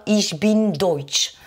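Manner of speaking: ich bin deutsch, said slowly in German; German pronunciation. Shown as a demonstration. Teacher shows students the German for I am German, slow German. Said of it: "pour dire je suis allemand, on va dire".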